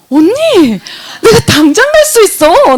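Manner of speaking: high, loud, stretched-out delighted cry; acted-out thrilled offer; excited eagerness. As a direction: jump at the chance, almost shouting. Acted jump at long-awaited order.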